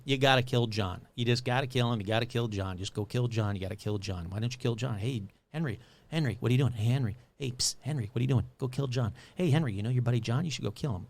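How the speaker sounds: low, soft, insistent murmur; voice in the head. Acted. Voice in Henry's head nagging him to kill John, hushed and insistent. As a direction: nag him quietly, like a voice in his ear. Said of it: "a voice in his head".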